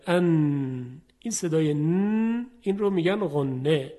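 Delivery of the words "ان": «ان» held with a nasal ghunna hum; nasal nun. Shown as a demonstration. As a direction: let «ان» ring through the nose. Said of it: "صدای نون غنه داره". («ان» با غنهٔ کشیده)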